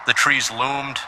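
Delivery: plain reading voice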